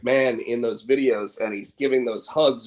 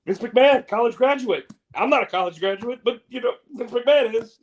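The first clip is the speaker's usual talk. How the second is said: much higher and louder hyped-up host voice; Pat McAfee impression; 10 semitones higher, 4 dB louder than usual. Host fawning over Vince, in a high, loud hyped-up voice. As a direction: gush like an over-excited talk show host. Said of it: "Pat McAfee's like".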